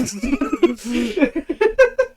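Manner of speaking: high rising burst of laughter; a big rising laugh at the punchline; hearty laugh. Real, not acted. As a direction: let the laugh climb up and out. Laughing after story.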